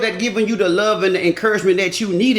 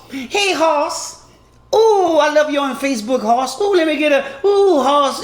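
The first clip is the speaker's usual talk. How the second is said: higher, laughing flirty fan voice; flirty female fan; 6 semitones higher than usual. Retold or imitated. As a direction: coo at him like a smitten fan. Women fans flirting with him.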